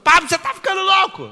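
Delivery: loud high mocking yell of a listener; loud, high mock outcry; mock shocked listener. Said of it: "imagined listener objecting".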